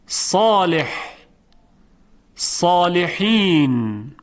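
صالح صالحین with a thick, full صا; heavy saad. Shown as a demonstration. Teacher showing the heavy صاد slowly. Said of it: "مثال برای حرف صاد".

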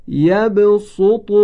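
يبسط chanted slow and high, a long drawn rise; Quranic recitation. Shown as a demonstration. Teacher recites a Quran word for students, slow chanted. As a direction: recite یبسط in melodic tajwid style, stretched. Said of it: "این کلمه هست".

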